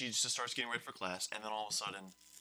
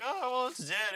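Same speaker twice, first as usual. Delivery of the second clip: much higher, silly voice; mock dense students; 14 semitones higher, 4 dB louder than usual. Students insisting the bird is dead, pitched way up. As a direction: squeak it out like clueless kids. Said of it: "of course they're like".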